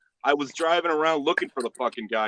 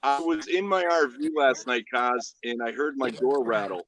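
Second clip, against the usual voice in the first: ordinary talk, nothing marked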